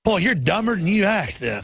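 ordinary talk, nothing marked

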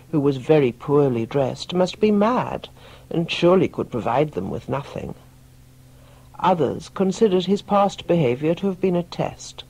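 ordinary delivery

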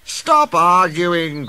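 slow, loud, high-pitched complaint; grumbling. A narrator's reading. Late passengers interrupt the quarrel, grumbling. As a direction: drag it out in exasperation. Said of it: "grumbled the passengers".

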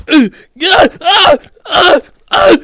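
high, loud repeated grunts; playful cries of struggle; strained yelps. Pretend. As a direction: yelp with mounting effort. Struggling in game.

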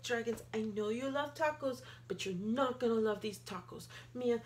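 plain reading voice

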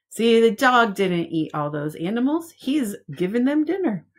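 lively picture-book read-aloud; reading to children. Acted. Teacher reading a picture book to preschoolers, lively storytime voice. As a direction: read it out like storytime for little ones.

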